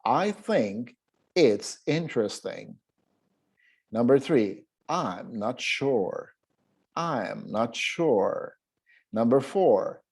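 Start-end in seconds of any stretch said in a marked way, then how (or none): 0.0-2.8 slow, careful "I think it's interesting"; slow model reading. Shown as a demonstration. Teacher shows students how to read number two slowly. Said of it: "how would we read number two". 4.9-8.6 "I'm not sure" drawn out very slowly; very slow model reading. Shown as a demonstration. Teacher models number three for the class, very slow. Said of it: "how would we read".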